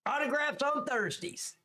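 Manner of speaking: put-on high squeal of a voice; mock high-pitched announcement. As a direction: repeat it way up high, playfully.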